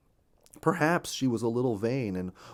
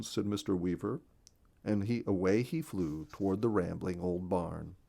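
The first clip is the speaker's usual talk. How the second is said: ordinary delivery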